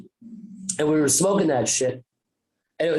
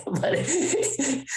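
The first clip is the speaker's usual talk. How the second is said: laughing; 10 semitones higher than usual. Real, not acted.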